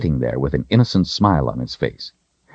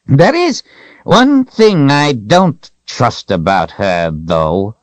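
put-on slow, high old man turning menacing; old wizard's drawn-out mock warning; dark, menacing; 8 semitones higher, 4 dB louder, slower than usual. A narrator's reading. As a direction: start high, drag it out and sink ominously. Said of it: "Zed's tone was dark, menacing".

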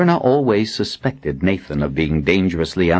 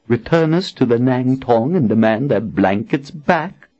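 put-on higher, scornful young woman; woman's voice; 6 semitones higher than usual. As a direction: voice Anne with scorn. Anne's voice in dialogue.

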